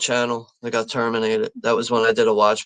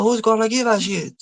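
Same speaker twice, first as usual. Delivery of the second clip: put-on high-pitched movie-quote Jamaican accent; 11 semitones higher than usual. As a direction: imitate a film character's Jamaican accent, pitched well up. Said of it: "really Jamaican with that accent".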